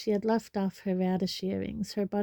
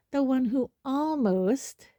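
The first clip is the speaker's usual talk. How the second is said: "almost" stretched out and raised in pitch; heavy emphasis on "almost"; 5 semitones higher, 3 dB louder, slower than usual. A narrator's reading. Hermione needling about the keeper, heavy pointed stress on "almost". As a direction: lean hard and slowly on "almost". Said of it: "a great deal of emphasis on the word".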